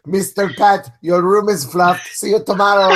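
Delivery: teasing impression in a high voice; imitating host's wife. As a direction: put on her voice, pitched well up. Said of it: "waiting for her to be like".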